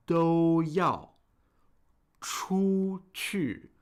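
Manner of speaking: 都要 and 出去 stretched, high level tone then sharp fall; slow tone demonstration. Shown as a demonstration. Teacher slowing down for learners, 都要 出去 in drawn-out tones. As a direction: hold 都 and 出 high, drop 要 and 去. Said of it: "speak a little, uh, more slowly".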